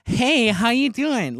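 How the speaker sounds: high, loud, drawn-out camp greeting. Retold or imitated. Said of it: "like, the stereotypical".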